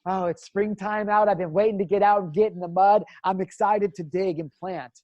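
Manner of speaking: bubbling over to get started; acted excitement. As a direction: act out eager anticipation, voice rising. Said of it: "It's the excitement of knowing".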